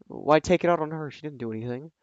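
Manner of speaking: plain reading voice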